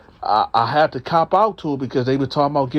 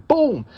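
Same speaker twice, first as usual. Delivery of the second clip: imitated smack; 13 semitones higher than usual. Smacking cellmate's face.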